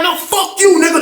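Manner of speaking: high-pitched mock cussing out; retold cursing match. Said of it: "this cursing each other out".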